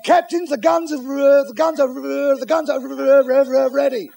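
high, loud, stuck stutter; stammering report. Acted. Bosun telling the captain about the guns, stammering loudly. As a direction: keep restarting until the last word pops out.

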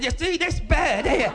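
slow, high, drawn-out holler; shouting for deaf gran. Acted. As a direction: labour the point for someone hard of hearing. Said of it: "my gran was a bit Mutt and Jeff".

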